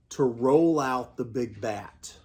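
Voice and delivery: usual speaking voice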